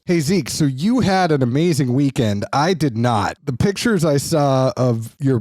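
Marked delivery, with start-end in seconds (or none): none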